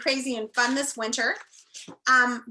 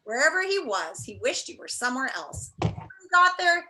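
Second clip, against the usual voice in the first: storybook read-aloud, a bit higher and livelier; expressive chapter-book reading; reading aloud to children; 3 semitones higher than usual. Acted. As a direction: read the opening to the class like a story. Teacher begins reading chapter aloud.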